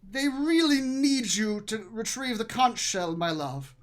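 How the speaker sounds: heightened husband's voice, easing off at the end; placating husband. Acted. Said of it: "he says".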